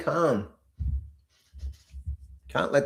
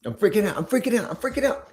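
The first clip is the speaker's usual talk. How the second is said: high, building mock panic; panicking trader, hammed up and higher; mock freak-out; 9 semitones higher, faster than usual. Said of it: "Don't let it get to you".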